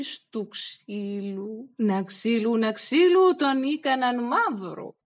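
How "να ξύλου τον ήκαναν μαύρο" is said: ordinary delivery, nothing marked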